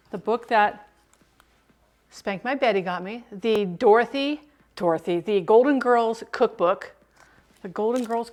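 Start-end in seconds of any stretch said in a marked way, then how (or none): none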